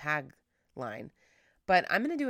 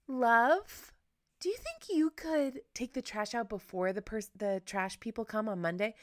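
higher, sweet asking voice; pleading ask tone; 6 semitones higher than usual. Shown as a demonstration. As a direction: coax him gently, a bit higher. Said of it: "I change my tone of voice".

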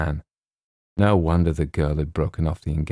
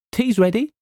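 much higher bright call; 4 dB louder than usual. Acted. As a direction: call out gaily across the porch. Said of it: "she called gaily".

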